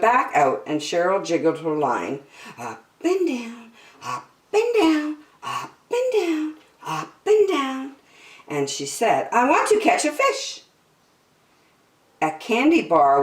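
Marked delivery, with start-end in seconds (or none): 2.6-8.0 seesaw the pitch like a jiggled line; singsong up and down. A narrator's reading. Narrator acts jiggling line. 9.3-10.6 put-on high, eager kid voice; impatient little girl.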